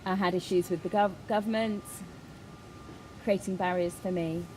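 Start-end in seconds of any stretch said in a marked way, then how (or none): none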